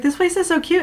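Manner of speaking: a high, excited gush; gushing Caroline. Retold or imitated. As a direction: coo over how cute it is. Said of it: "Caroline's like".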